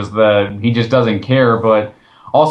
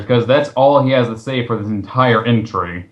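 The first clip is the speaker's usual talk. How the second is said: ordinary talk, nothing marked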